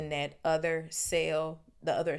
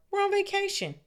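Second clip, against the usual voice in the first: much higher, louder, appalled; outraged teenage boy; horrified Ron; 9 semitones higher, 5 dB louder than usual. Acted. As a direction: squeal it in dismay. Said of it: "said Ron in horror".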